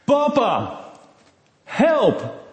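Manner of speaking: high, loud, drawn-out frightened call; trapped child calling desperately for father; cry for help. Acted. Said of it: "En ze riep". (angstige hulpkreet)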